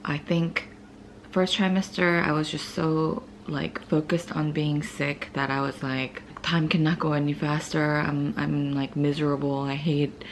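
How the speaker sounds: hushed morning chat, barely voiced. Real, not acted.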